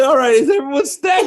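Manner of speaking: high, quick, laughing check on the toys; mock concern through laughter. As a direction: act out anxious care while cracking up. Acts himself checking on the toys.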